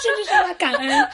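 laughing through the words; giggling as she speaks. Real, not acted.

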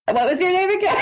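high-pitched retold awkward question. Drunk woman to a man she just met, awkward. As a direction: ask it high, about to crack up.

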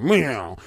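drawn-out low meow; low mocking meow. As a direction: meow like a cowed cat, deep. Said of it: "You got Mitch McConnell".

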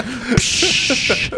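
a short breathy hiss of a fog machine; mock fog machine psh; imitated mist hiss. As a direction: spit out a mist machine puff.

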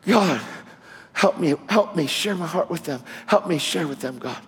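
retelling his daily prayer, hushed; quiet prayer. Retold or imitated. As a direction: pray it under your breath. Quoting his own daily prayer.

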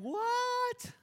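reacting to a two-day engagement, stunned; shocked what. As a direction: shriek what in disbelief. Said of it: "I'm like".

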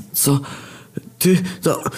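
halting, broken-off gasped words; stunned stammer. Acted. Demon who just watched his brother die, stunned stammer. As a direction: force out single words with gaps. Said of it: "próbowałem coś wystękać".

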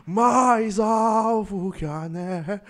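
slow, shivery mock gospel singing; hammed-up trembling worship croon. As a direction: sing it like a singer freezing cold. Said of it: "O meu é o [name] com frio".

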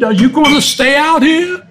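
high-pitched mock Australian questioning; screaming Aussie. Aussie to the swimmer still in the water, high imitated Australian holler. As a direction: imitate an incredulous Australian calling out. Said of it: "one Aussie, he screams at me".

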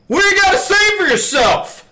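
mock bellow, loud and high; imitated old man's yell. Retelling an old man hailing a passing customer, imitated yell. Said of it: "before yelling this".

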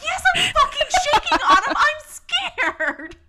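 high, loud, laughing shout; laughing yell. Retold or imitated. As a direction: yell it in mock panic, laughing. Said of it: "And I was like".

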